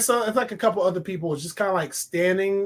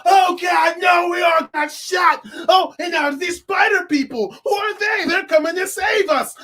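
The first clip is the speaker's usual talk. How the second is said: higher, louder hammed-up panicked cries; mock panic; 9 semitones higher, 6 dB louder than usual. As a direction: ham up the shot victim's alarm. Acting out the panicked victim.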